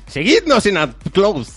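high, slow promo call; promo sign-off. Acted. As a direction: announce it like a radio plug. (anuncio promocional agudo y lento)

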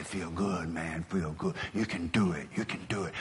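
low, hushed pep talk, retold; soft encouragement. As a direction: quietly imitate a reassuring mentor.

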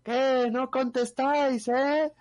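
high, stretched challenge to the neighbours; mock balcony call demanding an answer; grito pelado imitado. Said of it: "gritando a grito pelado, dice". (imitando a uno que vocea desde el balcón)

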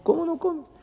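surprised. Retold or imitated. Rabbi Yehuda to Rabbi Shimon, high surprised disbelief. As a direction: sound shocked someone refuses.

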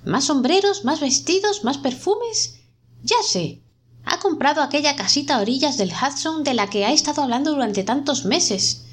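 high, rising, exasperated tirade; indignant rant. A narrator's reading. Smith rants alone about his wife's spending, indignant and high. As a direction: pile up the complaints, voice climbing.

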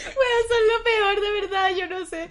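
high, loud, slow laugh-cry; laughing hard. Real, not acted. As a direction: dissolve into laughter while complaining. Laughing at fart story.

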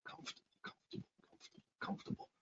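rapid unvoiced whisper; repeating to oneself. Shown as a demonstration. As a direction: whisper it over and over in a quick rhythm. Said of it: "try to say it a couple of times".

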